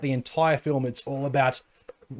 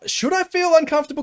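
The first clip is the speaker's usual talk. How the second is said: high, stunned, asking in disbelief; incredulous question; 13 semitones higher, 3 dB louder than usual. Retold or imitated. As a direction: squeak it out in shock. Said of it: "I'm just like".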